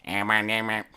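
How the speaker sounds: flat robotic toy voice; lightly voiced old Speak & Spell. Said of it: "in a robotic voice".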